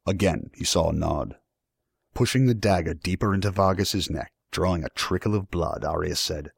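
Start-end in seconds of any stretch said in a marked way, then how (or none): none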